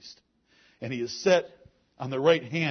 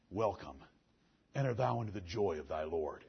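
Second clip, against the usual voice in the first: warm welcome; 5 semitones lower than usual. Shown as a demonstration. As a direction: welcome a faithful servant home gently. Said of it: "I want him saying".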